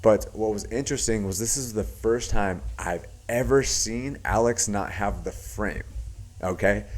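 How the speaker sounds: ordinary talk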